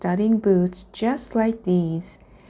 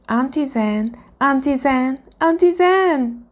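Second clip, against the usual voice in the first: calls building from high to higher, louder cry; rising panic; 6 semitones higher, 3 dB louder than usual. A narrator's reading. Dragonling calls his aunt again, panic building. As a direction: call for help, climbing to a shout. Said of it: "His panic crawled into his throat".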